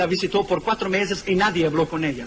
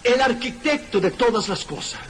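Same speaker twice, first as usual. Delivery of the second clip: preaching at full force; 4 semitones higher than usual. Acted. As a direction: hammer home that Christ is the foundation.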